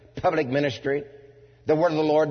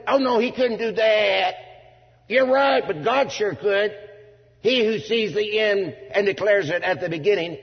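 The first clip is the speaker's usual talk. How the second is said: high-pitched, insistent pulpit argument; impassioned preaching; 8 semitones higher, 4 dB louder than usual. Real, not acted. Preacher defending the inerrant Word against doubters, impassioned preaching. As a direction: argue it hard at the congregation.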